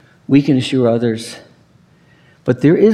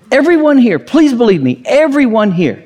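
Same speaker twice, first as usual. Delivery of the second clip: emphatic plea; 9 semitones higher, 4 dB louder than usual. Real, not acted. Preacher pleads with congregation.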